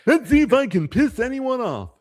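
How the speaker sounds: high goofy cartoon moose; sing-song dopey moose delivery; Bullwinkle voice. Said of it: "certain Bullwinkle things you would say".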